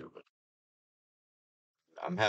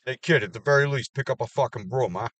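loud, higher mock order; barking at kid; 5 semitones higher, 7 dB louder than usual. Yelling at a lazy kid to sweep, loud. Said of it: "And it's fucking stupid".

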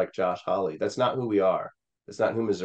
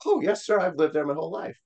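put-on higher, overly polite kid voice; eager younger self; 9 semitones higher than usual. Teen answering the friend's dad with a higher, bluffing politeness. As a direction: play your teen self bluffing brightly. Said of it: "I'm like".

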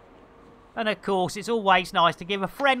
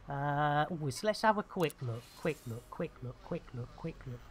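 muttering to himself; 6 semitones lower, 13 dB quieter, faster than usual. Real, not acted.

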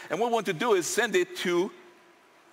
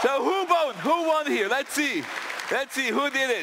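high, raised voice to a big room; excited calling out; 7 semitones higher than usual. Real, not acted. Presenter asking the crowd who won, excited. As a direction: build suspense for the crowd, pitched high.

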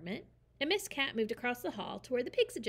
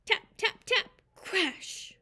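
high, louder taps; tapping; 10 semitones higher, 5 dB louder, slower than usual. A narrator's reading. Narrator acts out the mice knocking. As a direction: tap it out lightly then crash.

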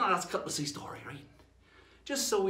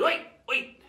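interrupting pirate; 6 semitones higher, 5 dB louder than usual. Acted. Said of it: "And he goes".

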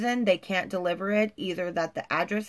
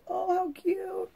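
cooing over cute toes; 13 semitones higher, slower than usual. Real, not acted. Gushing over painted toes.

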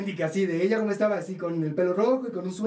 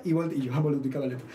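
usual speaking voice

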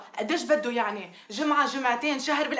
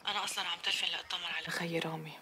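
hushed phone whisper; 3 semitones lower, 8 dB quieter than usual. Acted. Samra secretly on the phone to her aunt, hushed whisper. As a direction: keep it secret, barely voiced.

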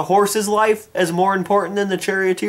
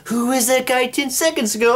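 mock gasp of surprise; 4 semitones higher than usual. Acted fooled guard after mustache.

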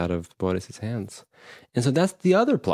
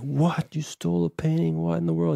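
higher-pitched mock disbelief; retold shock; 6 semitones higher than usual. Host voices Theo hearing the confession, mild shock. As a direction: act lightly stunned by the news.

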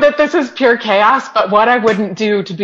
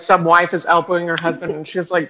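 normal talking voice

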